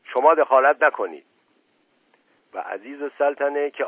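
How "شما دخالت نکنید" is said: loud, raised, curt rebuke; stern, higher-pitched reprimand; sharp tone. A narrator's reading. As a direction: warn them off sharply. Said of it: "با لحن تندی گفت".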